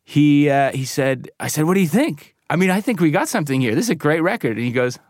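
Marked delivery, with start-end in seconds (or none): 1.6-4.6 higher-pitched, keen selling of it; retold enthusiasm. Retold pitch to unimpressed singer.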